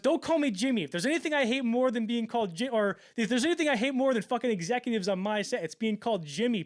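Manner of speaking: loud, high, furious yelling; furious shout. Retold or imitated. Cameron yelling at the executive who called him Jimmy, furious shout. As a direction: yell him off the set.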